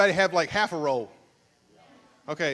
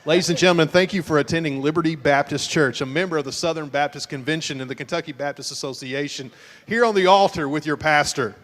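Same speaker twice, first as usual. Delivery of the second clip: louder formal announcer read; mock announcer disclaimer; 4 dB louder, faster than usual. Pastor interrupting the church game with a mock disclaimer, announcer voice.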